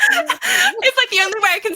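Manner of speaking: high, breaking into laughter mid-sentence; laughing while talking. Real, not acted. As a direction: giggle the words out. Laughing at knotting comment.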